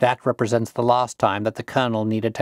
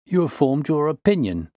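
higher-pitched, mildly surprised exclamation; surprised cry; 6 semitones higher than usual. A narrator's reading. Inspector to Holmes, mildly surprised cry. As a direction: lift the pitch in mild amazement. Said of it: "cried the inspector".

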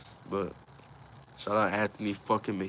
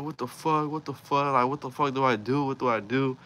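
high-pitched, frantic questioning; retold panic; 5 semitones higher than usual. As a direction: re-enact a panicked call for help. Retold panicked call to homie.

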